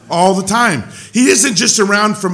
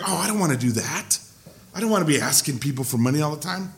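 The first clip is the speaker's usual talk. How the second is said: reluctant inner voice; 5 semitones lower, 8 dB quieter, faster than usual. Retold or imitated. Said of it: "at first I was like".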